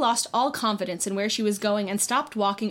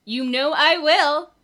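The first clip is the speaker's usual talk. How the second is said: confident; 5 semitones higher, 7 dB louder, slower than usual. A narrator's reading. Ginny's confident reply.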